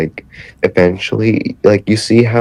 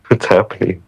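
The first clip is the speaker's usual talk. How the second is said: normal talking voice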